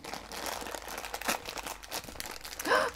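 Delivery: high breathy gasp of joy; delighted gasp. Real, not acted. Reveals favourite figure.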